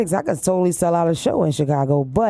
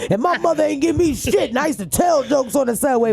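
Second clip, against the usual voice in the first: preachy veteran comic rant; old-school comedian voice; 4 semitones higher than usual. Retold or imitated. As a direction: lecture like a bitter old comic. Mocking old-school comedians' complaints.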